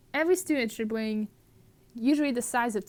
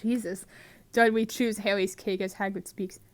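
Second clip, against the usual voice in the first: ordinary talk, nothing marked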